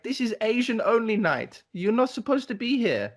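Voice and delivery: high-pitched, insistent telling-off; imitated female clubgoer. Woman explains the club night to intruders, high imitated scolding. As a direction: put on a high voice, laying down the rule. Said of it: "then she was like".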